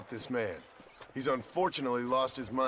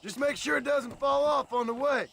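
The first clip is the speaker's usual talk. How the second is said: much higher, louder call over the noise; 12 semitones higher, 3 dB louder than usual. Acted. Partner on the wagon calls back over the noise, loud call. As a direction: shout it across the moving wagon.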